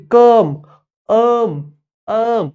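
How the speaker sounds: कम, अम, अम high and loud, "uh" not o; emphatic "kum, um, um" for कम, अम, अम; "uh" vowel demo. Shown as a demonstration. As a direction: drill कम then just अम, ringing the vowel. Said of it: "ओ चा pronunciation होत नाही".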